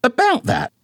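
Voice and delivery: high-pitched, huffy mother voice; indignant mama. A narrator's reading. Mama bickering with daddy about his bald spot, high and huffy.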